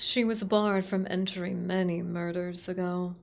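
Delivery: ordinary talk, nothing marked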